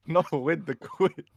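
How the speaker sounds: high, loud, laughing correction; amused, laughing objection; laughing denial. Real, not acted. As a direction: protest through laughter, drawn out. Laughs off the misunderstanding.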